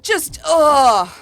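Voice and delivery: loud exasperated ugh; frustrated groan. Real, not acted. Frustrated by the spider boss.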